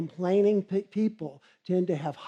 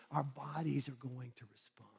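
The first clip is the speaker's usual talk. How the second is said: much softer, whispered warning; dramatic whisper; 5 semitones lower, 14 dB quieter than usual. Real, not acted. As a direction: let it land in a whisper. Speaker drops to dramatic whisper.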